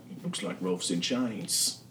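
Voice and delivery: soft, slow timid mumble; meek Shah. Acted. Shah timidly answering Knocker, soft and slow. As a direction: mutter it half to yourself. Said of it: "And Shah's like".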